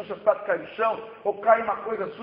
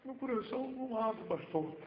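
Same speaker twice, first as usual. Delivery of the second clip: near-tearful plea; 2 semitones higher, 11 dB quieter than usual. Retold or imitated. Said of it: "ele quase chorando".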